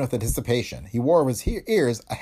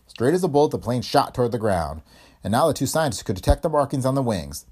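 plain reading voice

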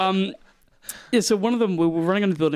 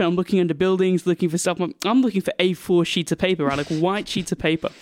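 ordinary talk

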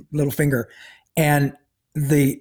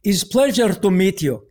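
put-on higher-pitched, deliberate Russian-accented delivery; 5 semitones higher than usual. Russian oncologist greeting his new patient, in a Russian accent. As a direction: imitate a Russian doctor's accent. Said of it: "Russian accent".

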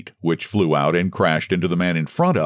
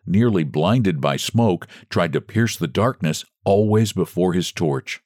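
usual speaking voice

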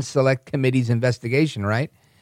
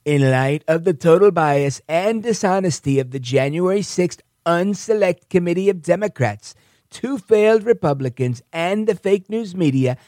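higher-pitched Donald Trump impression, emphatic; 5 semitones higher than usual. Host reading Trump's statement aloud, in Trump impression. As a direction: read the statement as Trump would say it. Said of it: "I gotta do that in my Trump voice".

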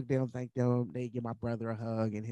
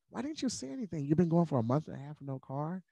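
much higher, lightly voiced astonished pastor; pastor's dismay; 6 semitones higher than usual. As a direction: gently scold out of concern.

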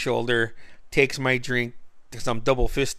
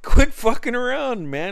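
high, louder imitation of an annoyed friend; mock scolding yell; 12 semitones higher, 6 dB louder than usual. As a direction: snap at him to stop.

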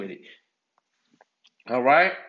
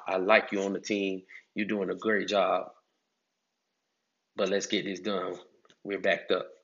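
lower, softer, calm encouragement; respectful boss; 5 semitones lower, 4 dB quieter than usual. Retold or imitated. Boss asking a worker to work harder, lower softer calm tone. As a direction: ask kindly, like a boss who values you. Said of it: "if the boss would've came to me like".

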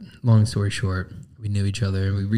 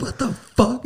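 imitating angry woman; slower than usual. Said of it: "she's like".

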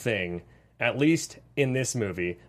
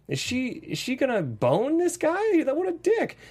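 shocked disbelief; 11 semitones higher than usual. Retold or imitated. Retold outraged reaction, pitch jump.

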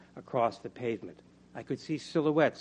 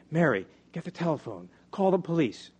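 raised, louder on the command; urgent order; 6 semitones higher, 5 dB louder than usual. Acted. Narrator tells Mary to phone for help, urgent order.

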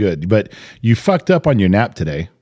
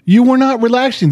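much higher and louder scolding; exasperated outburst; 13 semitones higher, 3 dB louder than usual. Real, not acted. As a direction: call her out, fed up. Scolding his partner over coffee.

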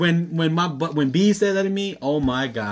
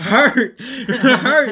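laughing; 9 semitones higher, 7 dB louder than usual. Real, not acted. Laughing retelling the hurt.

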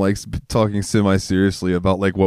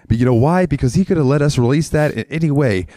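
higher, emphatic stage delivery; stand-up comedian bit; 5 semitones higher than usual. Retold or imitated. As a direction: preach it to a crowd like a comic. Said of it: "there used to be a comedian".

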